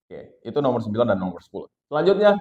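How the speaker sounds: ordinary talk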